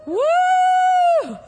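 long high, loud "woo"; excited whoop. Real, not acted. Preacher thrilled by the definition, long excited whoop. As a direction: hold out a big whoop.

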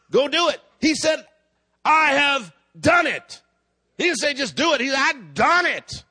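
forceful, high-pitched sermon delivery; preaching. Real, not acted. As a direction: preach it out with emphatic phrasing to the congregation. Preaching after telling a story.

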